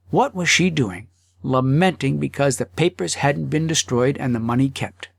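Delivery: ordinary delivery, nothing marked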